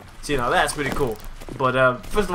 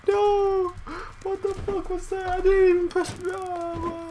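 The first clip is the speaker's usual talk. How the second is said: very high, squealing protest; high dismayed wail; 14 semitones higher, 3 dB quieter, slower than usual. Real, not acted. Dismayed after game death.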